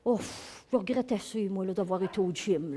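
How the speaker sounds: soft, half-whispered mock regret; mock regretful grumble. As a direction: sigh it out as a sulky gym-goer. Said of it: "tu reviens et tu dis". (regret moqueur, à mi-voix)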